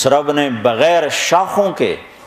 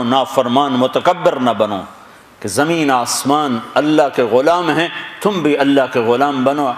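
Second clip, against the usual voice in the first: ordinary talk, nothing marked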